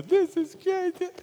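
very high, slow, loud strangled line; boy on the spinning ride realizing his mistake, strangled high and slow; strained panic. Retold or imitated.

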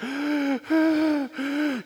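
loud, high gasp; boy finding the magazine gone, gasping loud and high; panicked gasp. Retold or imitated. Can't breathe panic.

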